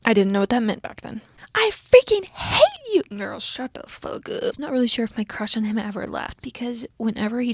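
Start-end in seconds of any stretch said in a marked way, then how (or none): 1.5-3.0 high, slow, hammed-up furious yell; mock angry outburst. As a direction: belt it out like a dramatic teen heroine. Reading a character's angry outburst.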